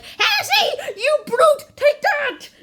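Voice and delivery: very high, loud comic shouting; mock fight cries. Lovers deciding to fight, mock battle cries. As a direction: squeal out hammed-up battle taunts.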